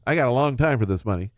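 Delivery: normal talking voice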